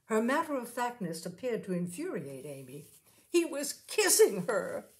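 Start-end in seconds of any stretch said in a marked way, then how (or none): 3.3-4.9 higher, louder, slow outburst; flung out. A narrator's reading. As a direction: hurl the words out at her friend. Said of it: "she flung out".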